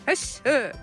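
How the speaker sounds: calling goats like a shepherd; herding call to the goats. Retold or imitated. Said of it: "this guy, he's saying".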